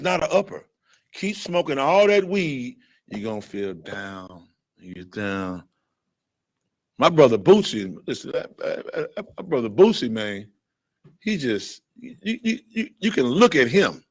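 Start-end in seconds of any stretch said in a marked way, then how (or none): none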